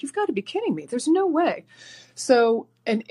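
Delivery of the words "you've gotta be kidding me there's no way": "You've gotta be kidding me" pitched much higher, exasperated; fed-up, incredulous, voice shooting up; exasperated disbelief. Retold or imitated. Frustrated caller retelling her outburst, high exasperated disbelief. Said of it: "I was like".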